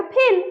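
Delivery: फेल with a puffed p, "p-hel"; no-dot pronunciation. Shown as a demonstration. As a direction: open फेल on aspirated p, not f. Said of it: "ऐसे नहीं रहेगा तो".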